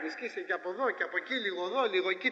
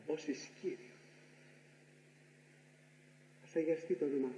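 prayer intonation; 7 semitones lower, 5 dB quieter than usual. Acted. Priest intoning a prayer to the congregation, solemn.

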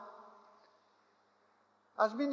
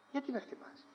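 gentle reproach; 5 semitones higher, 9 dB quieter than usual. Acted. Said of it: "Γυρίζει και του λέει".

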